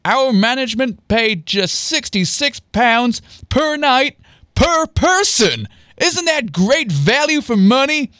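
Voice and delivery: high, slow showman's pitch; trumpeting proudly. A narrator's reading. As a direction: sell the bargain, stretching each figure. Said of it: "trumpeted Andy".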